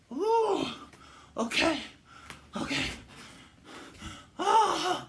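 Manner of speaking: loud, high, laughing cries of pain; high strained groans. Acted. Hunter recovering from the kick, high pained cries. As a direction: gasp it out, still doubled over.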